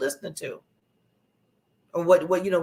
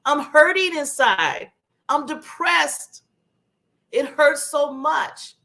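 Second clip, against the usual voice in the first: higher, slow, hurting voice; mild imitation of a hurting person, slow and high; pained cry for help; 7 semitones higher, 3 dB louder, slower than usual. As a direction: voice someone in pain reaching out. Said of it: "somebody shouts out".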